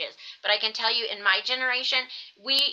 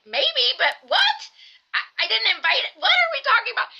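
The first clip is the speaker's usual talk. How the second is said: high, loud, slowed disbelief; baffled exasperation; 13 semitones higher, 5 dB louder than usual. Retold or imitated. Wife baffled at husband's cookie logic, high and loud. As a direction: act utterly confused by the question.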